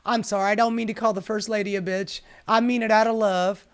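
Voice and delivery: ordinary talk, nothing marked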